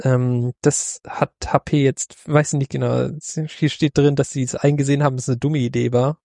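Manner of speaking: normal talking voice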